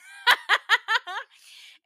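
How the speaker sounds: high loud burst of laughter; a big high cackle at the joke. Real, not acted. Laughs at coffee protest.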